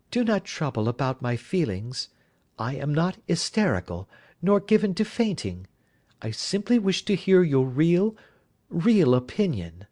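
high lady's voice, insisting she can take it; anxious wife, composed. Acted. Wife assures detective she is not hysterical, high insistent voice.

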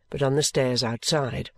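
usual speaking voice

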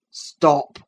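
loud sharp command; sharp cry. A narrator's reading. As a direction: snap it out. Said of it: "cried his father".

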